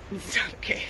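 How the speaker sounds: "It's not okay" breathed out, voice breaking, barely voiced; tearful whisper. Real, not acted. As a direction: let the tears through on "It's not okay". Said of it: "I held it in till the cameras are gone".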